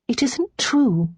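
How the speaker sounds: slow, rueful contradiction; rueful interruption. A narrator's reading. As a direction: interrupt with gentle regret. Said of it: "Lara interrupted ruefully".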